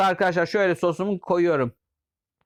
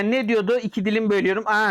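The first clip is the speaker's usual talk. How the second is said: ordinary talk, nothing marked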